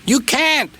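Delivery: high-pitched retort from a brake van. A narrator's reading. Brake van answering angry Donald, high and defiant. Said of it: "said the brake van".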